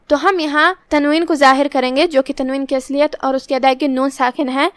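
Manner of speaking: ordinary delivery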